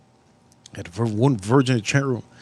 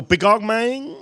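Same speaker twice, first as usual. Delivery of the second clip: imitating neighbor; 8 semitones higher, slower than usual. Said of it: "He go".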